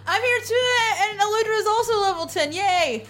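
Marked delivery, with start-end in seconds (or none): none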